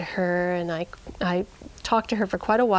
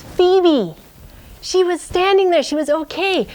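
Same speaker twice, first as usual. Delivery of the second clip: much higher and louder, joyful; excited reveal; 9 semitones higher, 6 dB louder than usual. A narrator's reading. Narrator finding the lost sheep alive, excited. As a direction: burst out with relief and joy.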